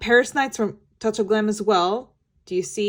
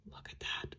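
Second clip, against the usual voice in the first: hushed whisper of awe; 21 dB quieter than usual. Real, not acted. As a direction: whisper it like a secret. Gushing over eyeshadow swatches.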